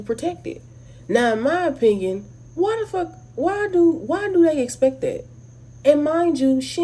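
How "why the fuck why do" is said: ordinary talk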